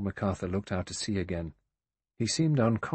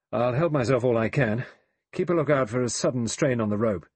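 louder, brisk practical call; calling instructions; 3 semitones higher, 6 dB louder than usual. A narrator's reading. Lombard to the rope holders before climbing, calling out. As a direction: project it to the men holding on.

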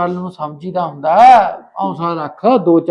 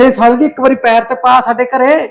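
higher and louder, insisting; loud urging; 8 semitones higher, 7 dB louder than usual. Acted. Man insisting his lover visit his house, loud and high. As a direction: push her hard to just come once.

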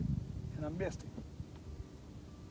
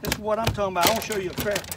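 louder, triumphant repeat; excited shout; 8 dB louder, much faster than usual. Real, not acted. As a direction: belt it out to the viewers over the catch. Angler shows off the crappie.

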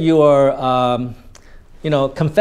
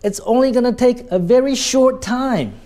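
short set off high and crisp before "time"; stress on short; 8 semitones higher than usual. Shown as a demonstration. Teacher showing consistent tonality, short emphasized high. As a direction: raise the pitch and hit short. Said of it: "When you want to emphasize short".

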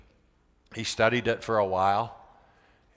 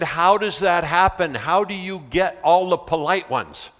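higher, louder eager questions; amazed student; 4 semitones higher, 5 dB louder than usual. Retold or imitated. Student eagerly asks his teacher how he got polite kids, raised and excited. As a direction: press him for the secret, still thrilled.

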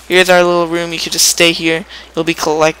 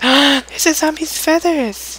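mock gasp; 11 semitones higher, slower than usual. Real, not acted. Spotting drop in game.

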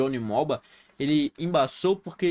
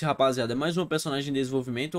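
ordinary talk, nothing marked

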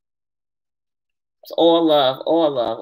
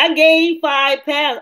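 mock complaining; 8 semitones higher, 3 dB louder, much slower than usual. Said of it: "Y'all know I'll be complaining".

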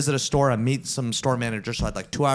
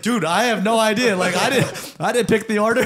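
higher-pitched pleading denial; defensive protest; 7 semitones higher, 3 dB louder than usual. Retold or imitated. Customer excuses himself to those still waiting, defensive protest. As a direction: retell it as innocent self-defence.

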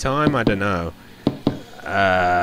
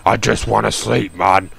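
creepy man voice. Acted. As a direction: play a creepy stranger, half-joking. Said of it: "creepy man voice aside".

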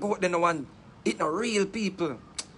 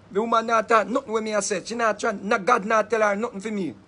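usual speaking voice

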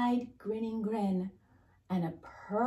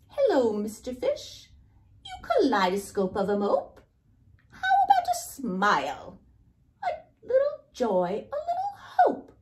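loud, high squeaky storybook squid; hammed-up pushy squid, voice shooting up; impolite squid; 6 semitones higher, 8 dB louder than usual. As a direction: pester a moper, climbing to a very high pitch.

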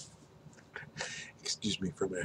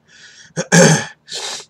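loud throat clear; 7 semitones higher, 16 dB louder than usual. Real, not acted. Burning throat.

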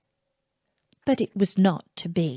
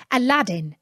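ordinary delivery, nothing marked

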